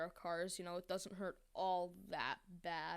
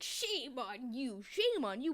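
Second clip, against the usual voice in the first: high, slow mocking cry; slow, loud mock scolding; mock indignant; 5 semitones higher, 5 dB louder, slower than usual. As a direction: drag out the indignation. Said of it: "and you're like".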